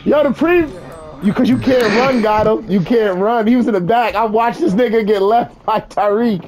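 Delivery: high, worked-up complaint; stressed out. Real, not acted. Friend tells squad who got left behind, stressed out.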